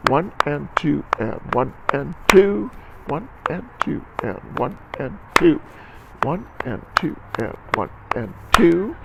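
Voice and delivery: evenly spaced count, leaning on the last "two" of a phrase; rhythmic counting. Shown as a demonstration. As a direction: count the beat aloud in strict rhythm. Clapping and counting the rhythm aloud.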